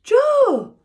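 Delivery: very high, loud call; emotional cry. Acted. Dad seeing his son return, crying out loudly. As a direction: cry out his son's name.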